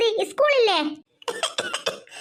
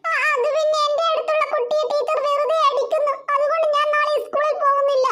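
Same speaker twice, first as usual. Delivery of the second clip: put-on higher child's voice, upset; sulky little girl; 5 semitones higher than usual. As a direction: play a small girl refusing school. Child Devu explains to mother. (പിണങ്ങിയ കൊച്ചുകുട്ടിയുടെ ശബ്ദം)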